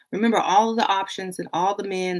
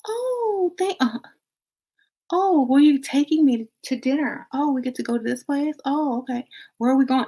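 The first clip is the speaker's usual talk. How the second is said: higher, breathy-start giddy gushing; giddy new date; 6 semitones higher, 4 dB quieter than usual. Acted. Woman gushing to new date about dinner, giddy. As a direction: play the thrilled new date being spoiled.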